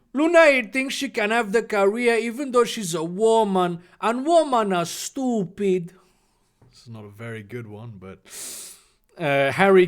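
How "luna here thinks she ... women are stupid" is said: put-on caricature Mexican accent. Draco sneering at Luna, smug caricature Mexican accent. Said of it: "Draco drawled smugly".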